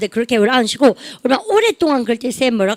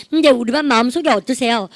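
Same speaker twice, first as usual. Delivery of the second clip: usual speaking voice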